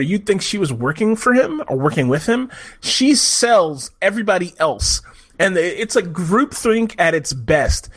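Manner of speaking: ordinary talk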